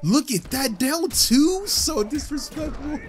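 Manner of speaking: high, incredulous protest; outraged disbelief. Real, not acted. Player at a disrespectful move, outraged. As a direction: squeal it in disbelief, slowing at the end.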